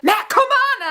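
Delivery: high, frantic retold call; urgent urging. Fan urging Matt to run over, urgent.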